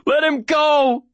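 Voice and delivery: high, stretched-out desperate demand; scream. A narrator's reading. Boy to man holding his dog, high desperate scream. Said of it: "I scream".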